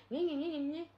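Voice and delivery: nasal taunting gna gna; mock her chatter; childish taunt; mocking babble.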